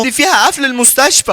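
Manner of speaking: high-pitched acted dismay; alarmed shock. Colleague learning of the surgical mistake, alarmed. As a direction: react as if disaster just landed.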